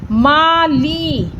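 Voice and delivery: "माली" very slowly, in two long syllables; teacher's drawn-out "माली"; stretched vowels. Shown as a demonstration. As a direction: draw out "मा", then a long ee on "ली". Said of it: "ला को खींच के बोला मैंने".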